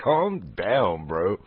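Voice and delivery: mumbled under the breath; soft calm down. Retold or imitated. Retelling what he told the delivery guy, a soft amused calm down. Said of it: "But it's like".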